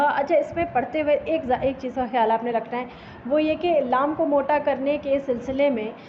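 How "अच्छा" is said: ordinary talk, nothing marked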